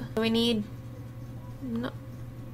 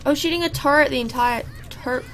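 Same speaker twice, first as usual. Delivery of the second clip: ordinary talk, nothing marked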